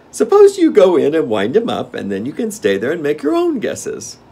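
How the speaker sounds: loud, high-pitched king's voice; commanding Gnome King. A narrator's reading. King sends Dorothy in to guess, loud and high. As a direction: send her in, high and forceful.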